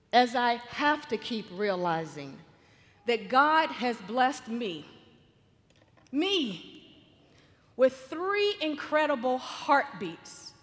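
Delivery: slow, measured preaching with weighted pauses; slow devotional reflection. Real, not acted. Speaker telling friends of her blessing, slow earnest reflection. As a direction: draw each phrase out and let it land.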